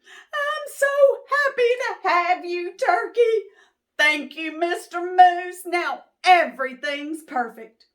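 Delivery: high, loud overjoyed squeal; delighted Missus Moose. Acted. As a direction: gush with delight, hands clapping. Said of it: "Missus Moose clapped her hooves".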